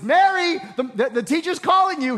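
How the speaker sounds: loud, high call across the house; excited sister calling Mary. Acted. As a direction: call your sister from afar.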